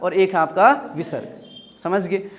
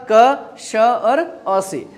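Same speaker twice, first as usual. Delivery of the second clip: very slow, each sound apart; slowly separated letter sounds; slow spelling out; much slower than usual. Shown as a demonstration. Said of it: "spelling out the parts".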